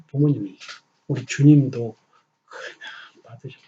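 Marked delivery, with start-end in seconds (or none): 2.4-3.6 slow voiceless whisper. Real, not acted. Pastor whispers that the Lord simply took it. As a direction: lean in and breathe it. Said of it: "whispered point in sermon".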